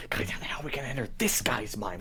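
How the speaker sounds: hushed, frantic whisper; whispered fretting. Acted. Demon fretting over getting into a mind, urgent whisper. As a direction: whisper it aside, exasperated.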